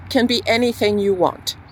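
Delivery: usual speaking voice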